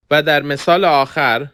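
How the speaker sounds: usual speaking voice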